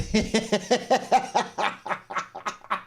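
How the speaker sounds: long, high staccato laugh; helpless run of high chuckles; real giggling laugh. Real, not acted. Host cracks up at the crying wrestler, long high laugh.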